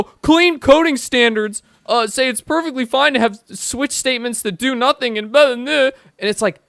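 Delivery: loud, high, heated rant. Real, not acted. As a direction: rant at the coders who disagree. Ranting about clean coding people.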